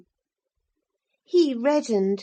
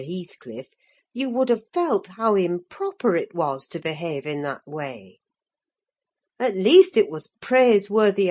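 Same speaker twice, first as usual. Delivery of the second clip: low, patient nurse's reproach; the old nurse gently reproves the girl; mild scolding housekeeper; 6 semitones lower than usual. Acted. Ellen's voice rebuking Catherine.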